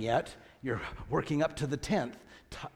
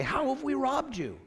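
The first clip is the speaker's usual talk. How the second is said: much higher-pitched quoted retort; protesting question; 10 semitones higher than usual. Acted. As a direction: voice the people's challenge on a high pitch. Voices the people's protesting question.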